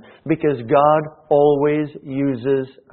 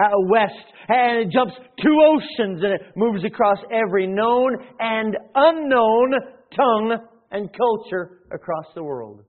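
preacher climbing to a peak; building preach; 8 semitones higher than usual. Acted. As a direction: swell the voice as the gospel spreads.

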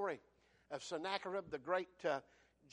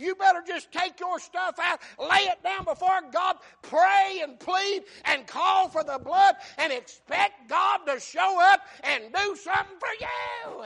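high, shouted exhortation; full-force preaching; 10 semitones higher, 14 dB louder than usual. Real, not acted. Preacher urges people to pray and plead, full-force shouting. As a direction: drive each phrase home at a shout.

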